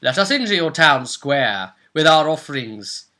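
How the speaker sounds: ordinary talk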